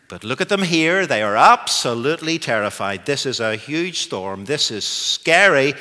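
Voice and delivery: loud, driving sermon delivery; booming pulpit voice; full-force preaching. Acted. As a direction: preach it out to the whole hall. Preaching the storm story.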